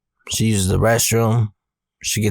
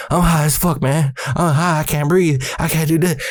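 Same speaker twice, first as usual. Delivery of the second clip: higher, panicky pleading; retold freak-out; 6 semitones higher than usual. Frightened teen pleads with his big brother, panicky. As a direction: act out a high kid losing his nerve. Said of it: "I'm, like, freaking out".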